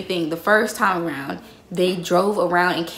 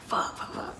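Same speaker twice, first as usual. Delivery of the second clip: unvoiced panicked "Fuck, fuck"; whispered curse; 14 dB quieter than usual. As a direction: hiss it under your breath while hiding. Hiding, caught out.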